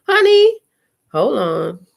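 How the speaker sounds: high, loud excited honey. Real, not acted. She is thrilled about her cute photo. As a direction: burst out with delight.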